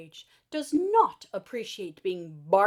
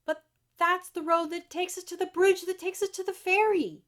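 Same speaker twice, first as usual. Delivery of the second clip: higher, anxious companion, worried about the ferry; Abba's voice, fretting at the closed road; fretful Abba voice; 7 semitones higher than usual. Acted.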